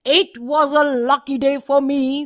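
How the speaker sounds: loud, high joyful whoop; relieved cheer. Acted. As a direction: whoop with relief after escaping. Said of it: "said Sheru".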